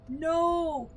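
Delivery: high loud cry of no; real anguished cry; despairing shout. Real, not acted. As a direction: wail it in real dismay. Lost progress.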